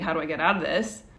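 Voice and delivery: ordinary talk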